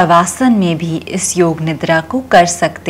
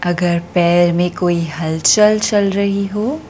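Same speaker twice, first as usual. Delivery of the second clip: slow, soothing yoga nidra voice with long pauses; guided meditation; 5 dB quieter, slower than usual. Acted. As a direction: stretch the key words and let each phrase settle. Leads yoga nidra relaxation.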